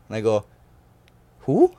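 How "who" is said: a high, loud, startled Who; baffled who. Retold or imitated. Boy who has never heard of the coach, baffled. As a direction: sound totally lost at the name.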